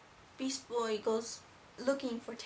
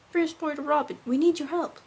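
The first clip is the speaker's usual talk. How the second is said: loud, higher urgent call; acted radio summons, voice raised and urgent; Robin; 5 semitones higher, 10 dB louder than usual. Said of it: "and Robin calls him saying".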